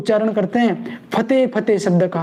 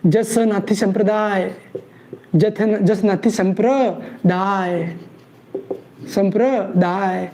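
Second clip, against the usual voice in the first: "जसनाथी संप्रदाय" said slowly, syllables spaced; slow drilled phrase; 2 semitones lower, much slower than usual. Shown as a demonstration. Teacher making students memorize the sect name, slow. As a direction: drag out "संप्रदाय" for the class to repeat. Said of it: "teacher drills pronunciation slowly".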